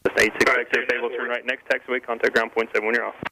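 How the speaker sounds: usual speaking voice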